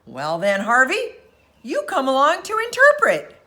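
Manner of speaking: a sailor thrilled to have found an interpreter; excited. A narrator's reading. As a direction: hurry him along with excitement. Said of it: "turned to him excitedly".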